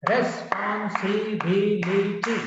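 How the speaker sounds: "Responsibility" in six slow, even beats; syllable by syllable. Shown as a demonstration. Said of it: "Six syllables are there".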